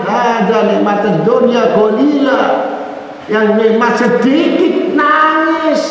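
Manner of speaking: high, impassioned sermon cry; a preacher thundering against hypocrites, in a high, fervent voice; fiery preaching. Acted. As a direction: rebuke the flock with rising fervor. Long impassioned sermon passage.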